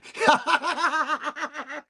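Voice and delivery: high, rapid cackle; laughing. Real, not acted. Streamer amused at hitting the machine, laughing high. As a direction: break into a long high laugh.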